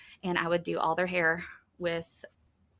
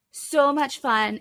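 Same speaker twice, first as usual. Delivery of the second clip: excited; 6 semitones higher, 6 dB louder, much slower than usual. Real, not acted. Throwing confetti streamers.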